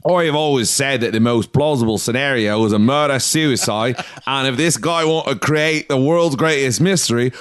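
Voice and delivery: broad mock Australian, stretched at the end; attempted Australian accent. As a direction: do a bad, overblown Australian accent. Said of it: "that's your Australian accent".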